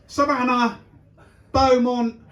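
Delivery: put-on high, loud call to a child. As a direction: holler the name like a parent. Mimics calling kids by caravan names.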